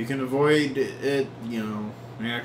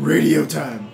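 loud, excited call-out; playful announcement; 3 semitones higher, 10 dB louder, slower than usual. Pretend. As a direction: sing out the news with a big burst. Player announces radio segment.

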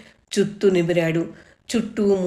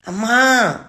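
calling out for mother, loud and high; boy's frantic loud call; loud shout; 4 semitones higher, 5 dB louder, slower than usual. A narrator's reading. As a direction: yell for mom in alarm. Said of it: "గట్టిగా అరుస్తూ".

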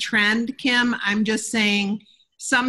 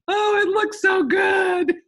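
longing fans; 11 semitones higher, 4 dB louder, slower than usual. Retold or imitated. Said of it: "They're going".

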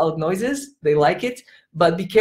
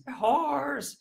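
soft, higher imitation monster; kids' monster echo; 7 semitones higher, 10 dB quieter than usual. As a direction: echo the monster roar, but quietly. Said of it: "And the kids".

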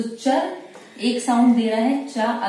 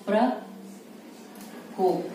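प्रकोप stretched out very slowly and quietly; slow dictation; 6 dB quieter, much slower than usual. Shown as a demonstration. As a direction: draw out प्रकोप as you write it. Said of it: "next है प्रकोप". (प्रकोप को बहुत धीरे खींचकर बोलना)

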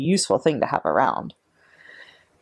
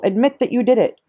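angry demand; 6 semitones higher, 3 dB louder than usual. A narrator's reading. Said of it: "roared the Trunchbull".

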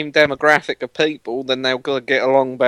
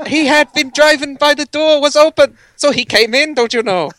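much higher, put-on Canadian lawyer voice; mock Canadian accent; 13 semitones higher, 3 dB louder than usual. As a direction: play the Nova Scotia lawyer for laughs. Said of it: "you're gonna want me to do this".

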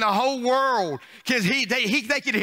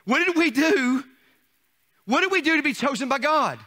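higher, louder preaching question; rhetorical cry; 6 semitones higher, 4 dB louder than usual. Acted. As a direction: lift the question to the room.

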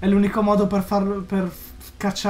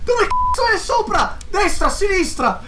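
high, panicked shouting; frantic yelling; 7 semitones higher than usual. Real, not acted. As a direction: yell in frantic fear. Frantic hunt for monster.

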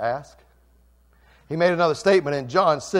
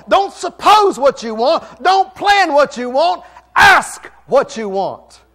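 much higher and louder, slow hammering preaching; preaching shout; 13 semitones higher, 13 dB louder than usual. Acted. Pastor pleading with the church to ask, preaching shout. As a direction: shout each line to the back pew.

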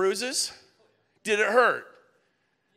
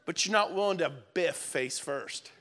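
ordinary talk, nothing marked

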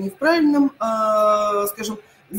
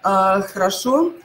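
usual speaking voice

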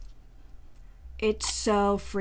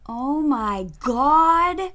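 amazed; 4 semitones higher, 10 dB louder, much slower than usual. Real, not acted. Amazed at the result.

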